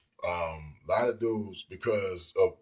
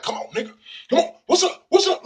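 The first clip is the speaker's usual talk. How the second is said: high, loud challenge to fight; squaring off; 8 dB louder than usual. Retold or imitated. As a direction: bark it like you're ready to brawl. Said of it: "she's down to square off".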